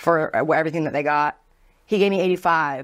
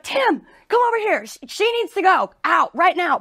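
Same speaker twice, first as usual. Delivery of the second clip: mock commanding coworker; 12 semitones higher than usual. Coworker ordering the bouncer Tim to remove her, high urgent command. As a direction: call the bouncer over, demanding. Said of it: "She's like".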